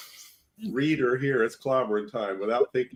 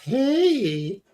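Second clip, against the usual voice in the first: high, put-on Thing greeting; a silly high Thing voice; Thing impression; 8 semitones higher than usual. Joking with friends as the Thing, high silly voice. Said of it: "it's me, the Thing".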